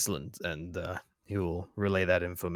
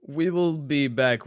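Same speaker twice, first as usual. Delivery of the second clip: higher and louder, slow; character voice; 8 semitones higher, 9 dB louder, slower than usual. Acted. Character promises the dragon a return, higher and louder. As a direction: speak up in character.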